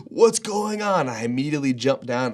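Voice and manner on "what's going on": alarmed. Retold or imitated. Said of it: "and I'm like".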